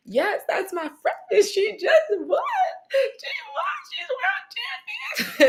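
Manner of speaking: crying with joy, slow and very high at the end; happy crying. Retold or imitated. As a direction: sob out the news at the top of the voice. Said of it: "I'm bawling".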